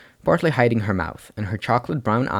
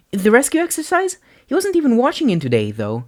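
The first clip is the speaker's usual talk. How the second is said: put-on much higher puzzled girl; bright, confused question; 4 dB louder than usual. Uraraka confused.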